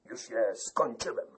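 zealous. A narrator's reading. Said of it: "z niezwykłym zapałem".

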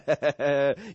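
high, loud laugh; hearty acted laughter; boastful laugh. A narrator's reading. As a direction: cap the boast with a rowdy laugh. Brawler laughs at his own boast.

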